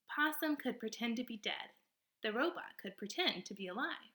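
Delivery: ordinary delivery, nothing marked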